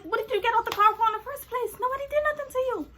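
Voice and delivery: fast, high-pitched protest; retold quarrel, pitch climbing fast; indignant. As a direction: argue back in a rush. Acting her side of quarrel.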